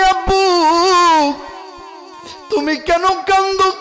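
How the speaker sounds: high, loud wailing call to father. A narrator's reading. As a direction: chant the child's cry on sustained high notes. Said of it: "ডাক দে কই".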